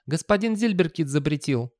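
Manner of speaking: high youth voice; skinny youngster. Acted. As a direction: answer the boss in a high young voice. Youngster answers boss.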